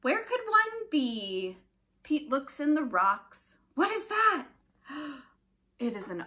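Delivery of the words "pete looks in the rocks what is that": usual speaking voice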